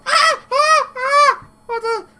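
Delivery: very high, loud yelps turning to whimpering; a kicked little kobold crying out; kobold in pain. Acted. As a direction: kobold yelping after losing his teeth. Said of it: "he rolls over and goes".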